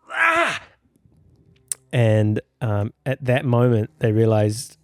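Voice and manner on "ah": mock scream. Friend bolting up on three, sudden high mock scream. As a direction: burst out with a yelp.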